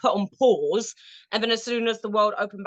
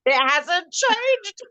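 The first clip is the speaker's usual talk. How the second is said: mock dramatic; 9 semitones higher than usual. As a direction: stretch it out mockingly.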